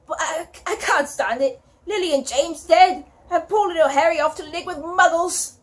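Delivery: a mildly voiced weeping Hagrid; tearful lament. A narrator's reading. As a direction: grieve for the lost parents through tears. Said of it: "sobbed Hagrid".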